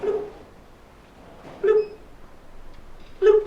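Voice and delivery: small imitated water drips, spaced apart; quiet drip noise. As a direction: pop out little isolated bloops. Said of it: "It was quiet".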